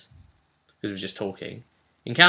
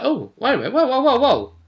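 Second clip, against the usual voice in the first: mock surprise; 13 semitones higher, 6 dB louder than usual. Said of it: "the others could be like".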